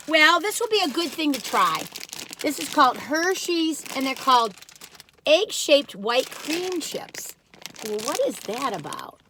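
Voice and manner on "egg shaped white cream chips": slow, drawn-out label read; slow product name. Real, not acted. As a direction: read each word of the label slowly. Reading the product name slowly.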